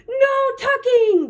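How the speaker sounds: high, drawn-out group cry; crew cry. A narrator's reading. Crew backs the captain on bedtime, crying out. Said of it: "the crew cried".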